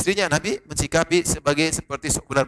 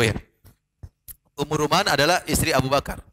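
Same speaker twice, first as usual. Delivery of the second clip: ordinary delivery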